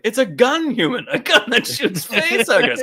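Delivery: high, gleeful villain showing off; hammed-up delight, edging into laughter; imitated elation. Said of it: "he's so elated".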